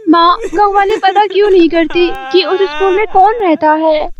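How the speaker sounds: put-on a curious little girl, voice up high; young girl voice. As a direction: ask mother like a worried child. Child Rinki asks mother. (माँ से पूछती छोटी बच्ची, ऊँची जिज्ञासु आवाज़)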